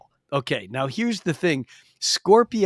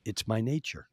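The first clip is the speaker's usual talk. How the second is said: low, soft, matter-of-fact punchline; understated scorpion confession; resigned scorpion; 5 semitones lower, 10 dB quieter than usual. Retold or imitated. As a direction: drop right down into quiet acceptance.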